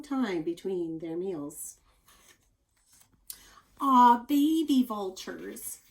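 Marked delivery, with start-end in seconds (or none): 3.8-5.8 slow, louder, higher coo. Real, not acted. Teacher cooing at a picture of baby vultures. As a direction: coo over something cute.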